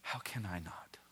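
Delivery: soft, almost whispered, heartfelt; hushed earnest question. Real, not acted. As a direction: ask it quietly to yourself.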